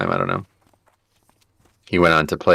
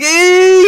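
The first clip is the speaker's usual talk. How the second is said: loud high-pitched panicked squeak; shrill mock panic squeak; weird squeal; 9 dB louder than usual. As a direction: squeal like a dying game character. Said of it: "he's making these weird noises".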